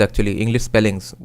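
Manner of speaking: ordinary talk, nothing marked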